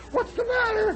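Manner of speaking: mock sweet girlfriend. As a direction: ask sweetly and cluelessly as the date. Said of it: "And Dorothy says".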